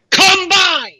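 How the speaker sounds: high, loud shouted word; shouting. Real, not acted. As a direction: punch it out as a shout. Host's rant climax.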